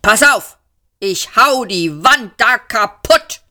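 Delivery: war machine working up to violence; raging threat. A narrator's reading. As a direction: hammer out each word. Raging machine threatens to wreck wall.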